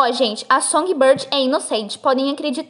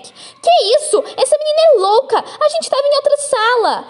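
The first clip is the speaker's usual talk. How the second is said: high, loud protest of innocence; indignant denial; 11 semitones higher, 4 dB louder than usual. Acted. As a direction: deny it with loud, high outrage. Impostora nega a acusação indignada. (indignada, negando alto e agudo)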